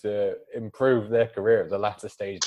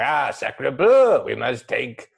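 put-on French accent; 2 semitones higher, 4 dB louder than usual. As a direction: ham up a French villain. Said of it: "French kidnappers going".

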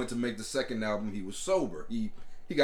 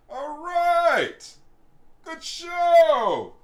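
loud cheer; 7 dB louder, much slower than usual. Real, not acted. Loud drunk cheering sign-off.

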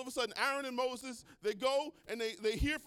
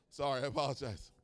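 ordinary talk

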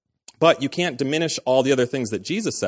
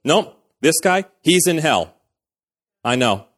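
a mock know-it-all, a bit higher and louder; smug certainty; 3 semitones higher, 5 dB louder than usual. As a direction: play the smug judge who's sure. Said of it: "it's that attitude that goes".

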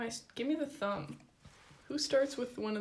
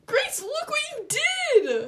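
high exasperated shout; 10 dB louder than usual. Real, not acted. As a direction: yell at her in dismay. Sister ruined the nail.

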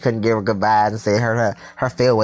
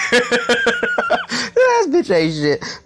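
"this bitch ain't shit" wheezed out high and slow through laughter; "this bitch ain't shit" stretched out, high-pitched, mid-laugh; much slower than usual. Real, not acted. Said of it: "I fell out".

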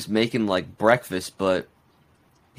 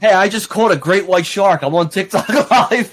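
mock excited streamer; 6 semitones higher, 3 dB louder than usual. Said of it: "imagined shark-catching streamer".

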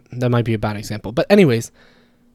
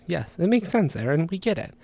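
higher, softer pretend listeners' reply; audience answering; 4 semitones higher, 7 dB quieter than usual. Host voices listeners humoring him, in a higher soft voice. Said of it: "just say yes".

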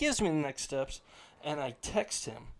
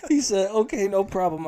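laughing through it; 6 semitones higher, 9 dB louder than usual. Real, not acted. Laughing while reading the texts.

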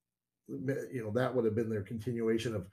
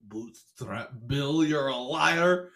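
strained, slow pirate line; forced pirate voice; much slower than usual. Acted. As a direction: squeeze it out like it hurts. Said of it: "that sounds so forced".

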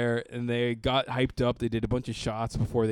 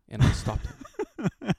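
mock proud hero; 11 semitones higher, slower than usual. Pretend heroic bro boast.

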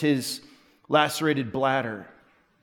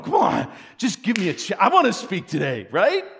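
eager to preach; 3 semitones higher than usual. Retold or imitated. Pastor recalling his urge to preach in chapel, excited. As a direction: beg for the pulpit.

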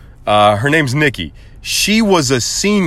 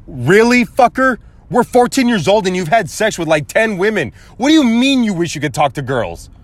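higher-pitched mock disbelief; retold outraged rant, pitched up; indignant comeback; 7 semitones higher than usual. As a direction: throw it back at him, incredulous.

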